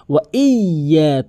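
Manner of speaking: "ওয়া ইয়া" slowly with y held double; correct doubled letter. Shown as a demonstration. Teacher showing tashdid, slow emphatic pronunciation. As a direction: lean hard on the y of "ইয়া". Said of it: "তাসদিদের উচ্চারণ double উচ্চারণ হয়".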